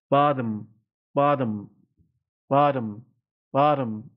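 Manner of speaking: "بادم" as American bottom, mouth wide on ah; American open A. Shown as a demonstration.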